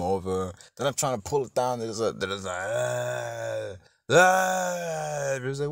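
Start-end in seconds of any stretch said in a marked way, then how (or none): 2.5-5.1 long drawn-out zombie groan; imitated undead wail; zombie moan. Imitates zombies pressed against gates.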